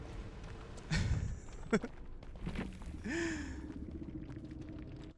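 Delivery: airy laugh, high; breathy laugh. Real, not acted. Laughs at her own protest.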